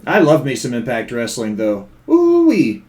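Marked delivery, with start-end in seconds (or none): 2.1-2.8 excited whoop. Real, not acted. Excited whoop about Impact.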